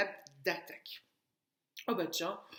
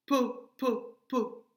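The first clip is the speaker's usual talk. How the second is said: "po" repeated as separate, punched beats; "po" chanted evenly on its own; isolated first syllable; 4 dB louder, much slower than usual. Shown as a demonstration. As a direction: hammer out "po" with a short gap each time. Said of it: "po est la première syllabe".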